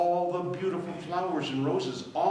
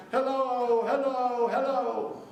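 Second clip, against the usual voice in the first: high echo dying away; fading echo; 9 semitones higher, 4 dB louder than usual. Acted. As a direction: let each hello fade like an echo. Echo answers from mountains.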